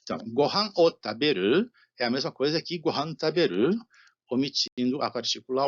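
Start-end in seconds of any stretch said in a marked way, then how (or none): 0.3-1.7 "Gohan o taberu" slowly, rising at the end of "taberu"; question intonation. Shown as a demonstration. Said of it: "Com a entonação a gente faz a pergunta". 2.8-3.7 "Gohan taberu" with a clear rise at the end of "taberu"; question intonation. Shown as a demonstration. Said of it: "Com a entonação a gente faz a pergunta".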